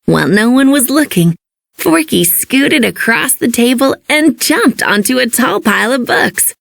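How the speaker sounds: plain reading voice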